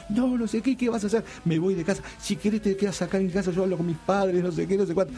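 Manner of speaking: high, worked-up girl's voice, imitated; teen imitating his frantic girlfriend, high and rushed. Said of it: "la mina estaba como loca".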